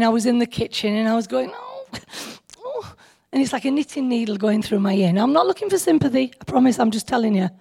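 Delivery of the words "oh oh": high, soft mock moans of ear pain. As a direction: moan softly, high up, like the earache hurts. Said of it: "I was moaning".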